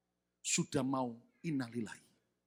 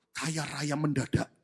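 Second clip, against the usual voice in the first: normal talking voice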